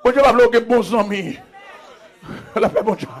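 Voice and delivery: usual speaking voice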